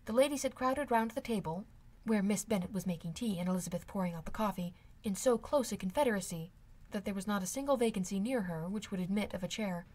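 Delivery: ordinary delivery, nothing marked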